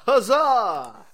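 loud, high cheer. Acted. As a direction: whoop it in victory. Celebrating XP.

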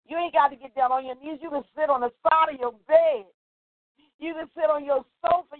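ordinary talk, nothing marked

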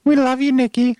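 high, loud shout-out to Nikki; calling out. Acted. Cohost shouting a greeting to absent Nikki, loud and high. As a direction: belt it out across the room, high and loud.

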